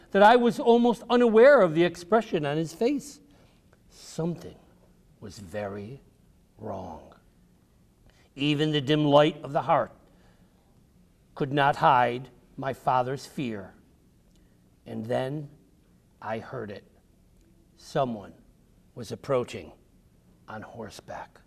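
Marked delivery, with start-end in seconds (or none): 3.9-7.1 softer and lower, building dread; ominous hushed narration; hushed suspense. A narrator's reading. 14.8-21.4 hushed suspense. A narrator's reading. Suspense narration.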